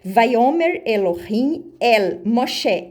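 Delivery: "Vayomer Elohim el Moshe" drawn out slowly, every word distinct; Hebrew read slowly. Shown as a demonstration. Preacher reading the Hebrew verse to his brothers, slow. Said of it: "Vou ler pra vocês".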